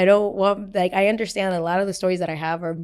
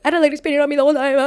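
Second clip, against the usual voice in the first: high, loud, rapid-fire retold wail; mock tearful outburst; 7 semitones higher, 7 dB louder, much faster than usual. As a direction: gabble it like you're about to break down crying. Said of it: "Then I started uncontrollably crying".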